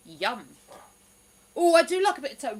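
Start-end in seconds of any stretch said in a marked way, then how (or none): 1.6-2.6 loud, fast, delighted; excited. Real, not acted. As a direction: gush over the toast. Excited about viewer's toast.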